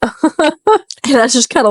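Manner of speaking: laughing through it; words dissolving into laughter; a real giggle. Real, not acted. Laughing at her own story.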